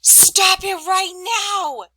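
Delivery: loud, high and very slow; teacher demonstrating an angry exclamation; angry command. Shown as a demonstration. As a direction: lay down the law, furious. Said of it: "using a lot of emotion in your voice".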